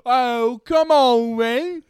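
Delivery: high, loud Cockney banter; mock Cockney accent. Podcaster carrying on the joke impression, loud mock Cockney accent. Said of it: "trying to do a Cockney accent".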